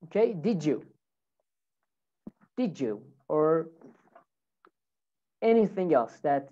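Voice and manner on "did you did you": "did you" run together as "didjoo"; "did you" with a J in the middle; linked J sound. Shown as a demonstration. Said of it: "it makes a J sound".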